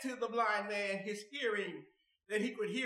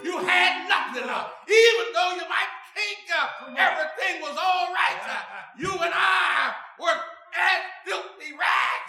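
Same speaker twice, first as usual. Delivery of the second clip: loud, raised preacher's shout; fervent preaching; 6 semitones higher, 6 dB louder than usual. Real, not acted. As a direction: drive it home to the congregation at full force. Preaching at full force.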